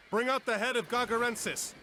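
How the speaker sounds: commanding order. Acted. Captain ordering the crew to lash the head, commanding. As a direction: bark it across the deck.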